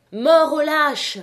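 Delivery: cri plus haut et plus fort, très lent; lancez-le comme une foule déchaînée; clameur de tempête. A narrator's reading. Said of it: "avec leur souffle de tempête". (cri de foule lent et grondant)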